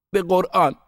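very high, loud vow; desperate oath. A narrator's reading. Boy made to swear on the Quran, desperate oath.

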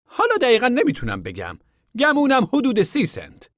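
a high voice for the stranger; high contractor voice. A narrator's reading. Contractor asking the camp men about work, high voice.

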